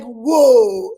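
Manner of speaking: loud amazed whoa. Retold or imitated. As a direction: exclaim in delighted amazement. Amazed at children spelling.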